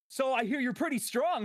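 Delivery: a hint of a cocky fighter; Goku cosplayer voice. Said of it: "he'll be like".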